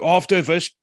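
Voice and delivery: put-on high South African accent, started then dropped. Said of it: "I'm doing my best idiot South African accent here".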